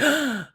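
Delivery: quick, audible intake of breath; mock gasp of awkward panic; panicked gasp. Retelling a moment of social awkwardness to the hosts, mock panicked gasp. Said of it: "is that moment of".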